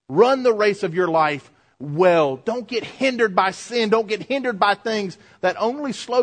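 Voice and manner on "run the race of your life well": usual speaking voice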